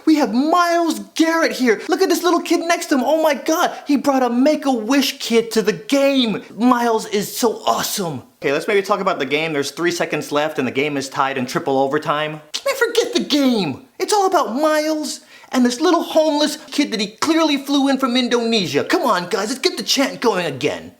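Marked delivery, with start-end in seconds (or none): 0.1-8.2 high, excited mock commentary; gushing arena announcer. Announcer spotting Myles on the Jumbotron, high gushing excitement. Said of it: "throw us on the Jumbotron and be like". 12.7-20.9 high, hyped mock commentary easing down; excitable arena announcer. Announcer forgetting the game for Myles, high hyped-up excitement.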